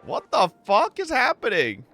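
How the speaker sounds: ordinary talk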